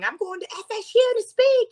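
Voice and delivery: retelling the big news, squealing with excitement; excited squeal. Retold or imitated. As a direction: jump for joy as you say it. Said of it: "us jumping up and down and going".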